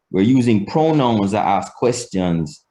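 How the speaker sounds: ordinary talk, nothing marked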